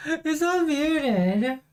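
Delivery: slow, high, stretched in disbelief; drawn-out disbelief. Real, not acted. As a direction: say it slowly, stunned.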